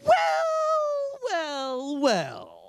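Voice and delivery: first well swooping very high and loud, then falling; gloating well well well. Retold or imitated. Niece lording it over family, sing-song gloat. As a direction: savour the triumph, stretching each well.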